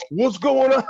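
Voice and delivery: wedding guests startled by the megaphone. Retold or imitated. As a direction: sound thrown off mid-ceremony. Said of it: "they're stopping like".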